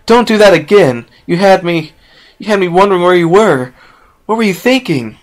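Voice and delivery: high, loud scolding yell. Acted. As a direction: yell at her out of fear turned to relief. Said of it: "Despite her yelling".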